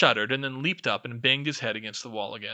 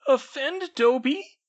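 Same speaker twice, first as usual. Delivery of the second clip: very high, slow elf voice choking up; choked; 7 dB louder, slower than usual. A narrator's reading. House elf overwhelmed by Harry's kindness, choking up. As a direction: squeak it through tears. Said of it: "the elf choked".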